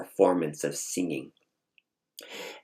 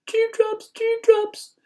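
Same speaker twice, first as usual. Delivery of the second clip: tearing up; 4 dB louder, slower than usual. Retold or imitated.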